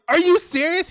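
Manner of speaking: very high, loud mock astonishment; shocked disbelief. Teller replays learning the guitar was lent, loudly shocked. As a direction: shriek the question in retold shock. Acted disbelief at owner's claim.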